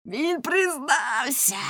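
high, long-drawn shout; triumphant cry. A narrator's reading. Fishmonger seizing on the confession, crying out. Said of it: "крикнув Рибник".

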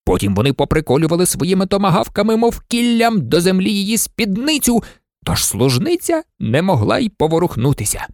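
ordinary delivery

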